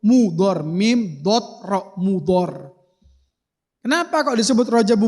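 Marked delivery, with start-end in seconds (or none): 0.0-2.8 MUDHOR without h, then its letters one at a time; Mudhor spelled out. Shown as a demonstration. Preacher spelling the name for the congregation, Mudhor without H. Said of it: "Ndak ada haknya".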